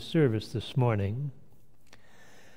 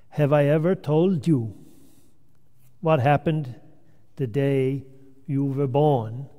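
put-on grandmother's question in a Finnish English accent. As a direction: ask it with a Finnish accent, firmly. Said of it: "in her Finnish English accent".

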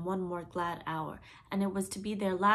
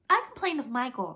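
instantly; 6 semitones higher, 8 dB louder than usual. A narrator's reading. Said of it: "said Curly instantly".

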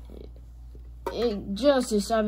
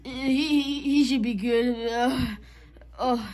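normal talking voice

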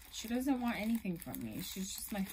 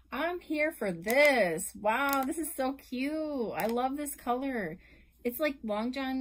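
louder, slowed-down delight; thrilled gush; 3 semitones higher, 8 dB louder than usual. Real, not acted. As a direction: savour the excitement, drawing it out. Excited reaction opening the gift.

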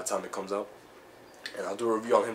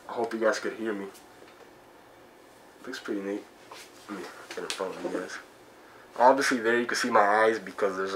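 muffled behind rubber mask; speaking inside full-head mask; through a face mask; 4 dB louder than usual. Real, not acted. Seen 1: Full latex mask covers his face and mouth throughout the words.

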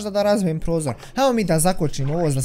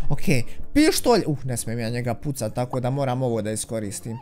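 ordinary delivery

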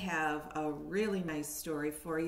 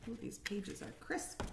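whispering aside; 4 semitones higher, 8 dB quieter than usual. Real, not acted. Aside about new book.

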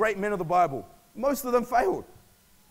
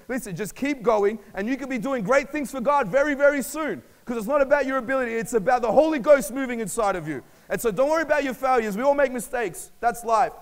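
higher, louder rallying exhortation; impassioned preaching; 5 semitones higher, 6 dB louder than usual. Real, not acted. Preacher urging the church to keep going, impassioned. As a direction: drive it home to the congregation.

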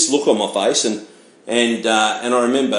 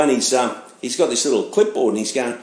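normal talking voice

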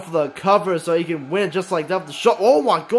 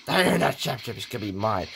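lower, fast mock-menacing brag; villain boast; 9 semitones lower, 6 dB quieter, faster than usual. Bendy claiming Simon's title, low quick gloat. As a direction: rattle off the threat with a sneer.

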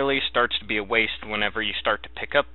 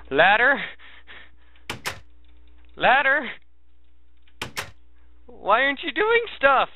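helium voice; 13 semitones higher than usual. Real, not acted. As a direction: squeak it out like a cartoon. Pleading with the game, squeaky.

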